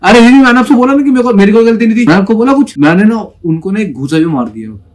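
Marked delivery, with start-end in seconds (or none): 0.0-2.8 high, loud and fast, fed up; retelling an angry answer to the senior girl; angry retort. Retold or imitated. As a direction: snap back at the senior in anger. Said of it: "मेरे को आ गया गुस्सा".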